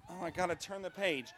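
ordinary talk

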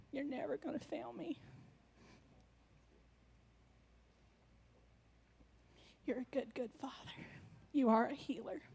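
soft, hushed praise to God; whispered prayer. Real, not acted. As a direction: breathe the prayer, barely voiced.

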